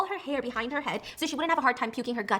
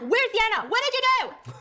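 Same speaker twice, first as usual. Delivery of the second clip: high, loud mock yell at him; raised, frantic demand for where Yana is; retold shout; 12 semitones higher, 4 dB louder than usual. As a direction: shout it as if echoing through the ER. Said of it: "My voice is echoing in the emergency room".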